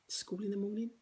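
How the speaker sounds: whispered aside; hushed school-night reminder. Real, not acted.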